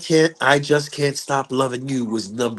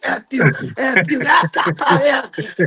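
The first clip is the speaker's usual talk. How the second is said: high, frantic volley of curses; hammed-up imitation of two men spitting insults; cursing like crazy; 11 semitones higher than usual. Two fathers cursing in each other's face, frantic. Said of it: "They cursing like crazy, back and forth".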